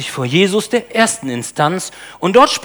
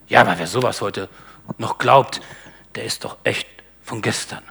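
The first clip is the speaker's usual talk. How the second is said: lower, scoffing objector fading to a whisper; mock skeptic; 8 semitones lower, 4 dB quieter than usual. Preacher voicing a scoffing skeptic, low and dismissive. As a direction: sneer it like a modern doubter.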